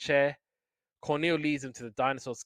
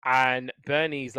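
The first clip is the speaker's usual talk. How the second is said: normal talking voice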